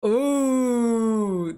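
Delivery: long sustained "ooh", slowly sinking; drawn-out ooh. Real, not acted. Watcher sees no penalty coming, long falling ooh. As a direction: hold the ooh and let it fall. Reacting to possible Chelsea penalty.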